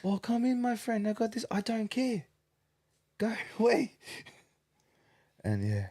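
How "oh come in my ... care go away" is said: high, eager hawker calling out; mock the sellers' pushy welcome; hammed-up street vendor greeting; market seller voice. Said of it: "it's like".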